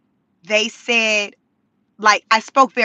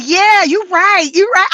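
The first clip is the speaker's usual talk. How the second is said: a hint of a girl agreeing, high and slow; agreeing classmate; 7 semitones higher, 4 dB louder than usual. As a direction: nod along in mock awe. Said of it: "The other girl like".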